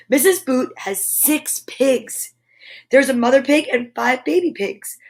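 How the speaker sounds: bright, lively storybook read-aloud; animated picture-book narration; reading to children. A narrator's reading. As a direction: read it like story time for little ones. Reads picture book to kids.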